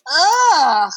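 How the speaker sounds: loud, high groan of disgust; a spoiled, disgusted groan; disgusted ugh. Acted. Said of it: "said Toby Town Mouse".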